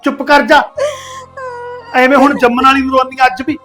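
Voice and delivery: put-on loud, sharp scolding of a weeping girl; harsh scolding. Aunt loudly scolding her crying niece on her wedding day.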